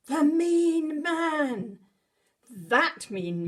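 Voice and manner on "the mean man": slow, loud, higher reveal; storyteller springing the big scare; dramatic reveal. A narrator's reading. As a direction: announce the villain with relish.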